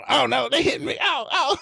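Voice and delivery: very high, loud comic yelps; hammed-up cries of pain; mock pained wailing. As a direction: act out taking a beating, crying out. Acts out being beaten up.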